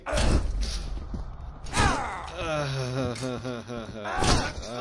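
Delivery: despairing laugh. Real, not acted.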